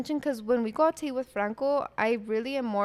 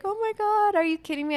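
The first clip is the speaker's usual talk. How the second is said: gasping disbelief, pitched up; mock surprise; 10 semitones higher, 4 dB louder than usual. Retelling her birthday surprise, high surprised gasp. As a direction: squeal it like the surprise just hit. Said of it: "I was like".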